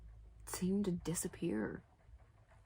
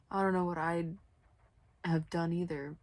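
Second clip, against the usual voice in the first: ordinary delivery, nothing marked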